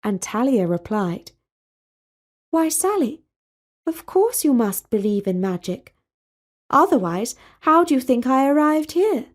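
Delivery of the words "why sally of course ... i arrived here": put-on higher, poised young princess, earnest about magic; princess Talia's voice. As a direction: play the serene princess, raised pitch.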